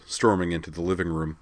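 ordinary delivery, nothing marked

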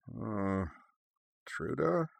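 soft, sleepy question, rising at the end; just woken, groping for words; bleary wondering. A narrator's reading. Woman waking in the gloom to her partner, bleary. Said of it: "Erika wondered".

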